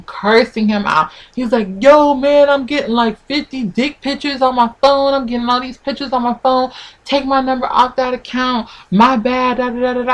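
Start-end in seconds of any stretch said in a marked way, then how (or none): 1.8-10.1 higher-pitched mock complaint from a guy; imitated complaining man. Guy begging her to stop the pictures, higher mimicked complaint. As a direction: lightly mimic his pleading protest, pitched up. Acted ex complaining about flooded phone.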